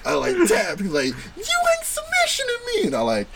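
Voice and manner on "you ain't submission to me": tiny, very high defiant voice; imitated defiant kid. Little kid refusing to tap, squeaky defiance.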